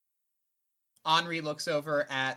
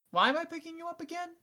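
high mock confusion; 13 semitones higher, faster than usual. Mock-confused question to John.

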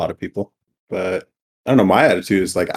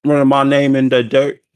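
loud, higher Southern indignation; mock Southern accent; 6 semitones higher, 5 dB louder than usual. Sibling parroting his brother's complaint, mock Southern accent.

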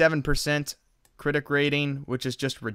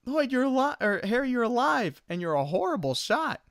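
much higher goofy voice; dumb happy surprise; 10 semitones higher than usual. Retold or imitated. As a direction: blurt it out like a delighted idiot. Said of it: "and he's just like".

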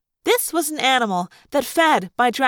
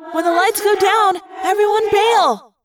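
urgent cry; 9 semitones higher, 4 dB louder than usual. A narrator's reading. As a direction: cry out the plan to the whole group. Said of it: "Cassie cried".

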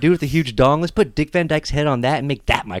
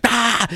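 mock yell; 11 semitones higher than usual. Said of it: "he's like".

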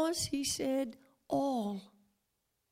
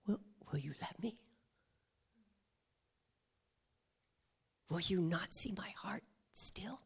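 softer, hushed tender plea; Holy Spirit pleading; 6 dB quieter than usual. Acted. Holy Spirit pleading with the listener, whispered. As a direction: drop to a whispered appeal. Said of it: "That was the Holy Spirit".

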